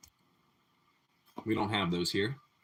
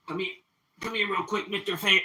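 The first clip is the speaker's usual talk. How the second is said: softer, higher coaxing of a cat; pet talk; 6 semitones higher, 7 dB quieter than usual. Real, not acted. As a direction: coax the cat over, gently and a bit higher. Calling the cat to the camera.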